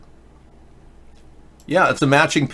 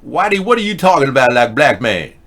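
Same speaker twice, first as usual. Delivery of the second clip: mock street voice; 4 semitones higher, 6 dB louder than usual. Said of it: "they'd be looking at me going".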